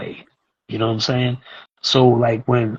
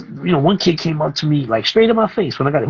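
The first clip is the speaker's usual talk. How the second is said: usual speaking voice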